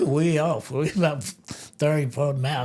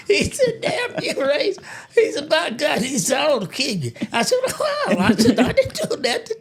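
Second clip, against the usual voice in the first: high, giggling through each quote; laughing; 9 semitones higher, 3 dB louder than usual. Real, not acted. Telling friend he switched plates, laughing. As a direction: keep breaking into laughter. Friend angry, teller cracking up.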